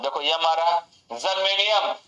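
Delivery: ordinary delivery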